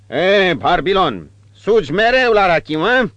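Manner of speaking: high, loud boastful brute; rough bandit voice. Acted. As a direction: crow it out like a tavern bully. Cuțitar voiced figure.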